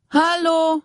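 high, loud call from a distance; calling from outside. A narrator's reading. Siving calls in from outside, high and loud. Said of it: "rief Siving draußen".

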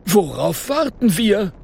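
high, excited professor calling out; the professor can't wait, push it out high; eager call. A narrator's reading. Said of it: "ruft der Professor".

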